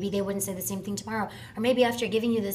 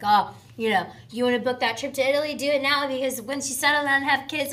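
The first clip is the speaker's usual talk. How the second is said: loud, higher, preachy mock voice; mocking quote; 6 semitones higher, 5 dB louder than usual. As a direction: lecture it like a know-it-all elder. Mocking quote of older people's advice.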